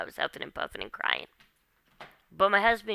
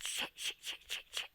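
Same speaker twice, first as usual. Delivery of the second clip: urgent unvoiced whispered curses; frantic whisper; 12 dB quieter than usual. Hiding on the neighbor's porch, whispering in panic. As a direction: hiss the curses so nobody inside hears. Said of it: "and I said".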